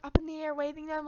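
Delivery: ordinary talk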